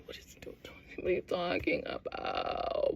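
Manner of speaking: stretched-out mock whisper; retold baffled whisper.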